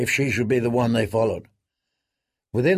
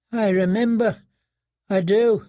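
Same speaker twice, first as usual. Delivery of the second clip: high, cowering whimper; broken prisoner; 8 semitones higher than usual. A narrator's reading. Prisoner answering the boys in tears, high and cowed.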